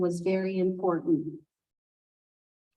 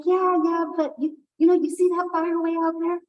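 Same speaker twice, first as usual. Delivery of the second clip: higher, louder, eager pointing; coaxing Raven; 7 semitones higher, 4 dB louder, faster than usual. Acted. Raven urging Hawk toward the far fire, eager. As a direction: sell the idea with excitement. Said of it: "He's like".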